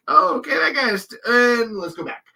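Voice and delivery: high, hurried reaction; a high-pitched mock "oh, okay, moving on"; awkward double take. Acting out noticing the starer.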